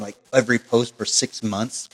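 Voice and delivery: ordinary delivery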